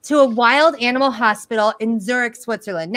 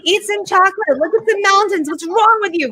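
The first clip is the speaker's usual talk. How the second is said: exasperated retort; 8 semitones higher than usual. Retold or imitated. Retorting to sister-in-law.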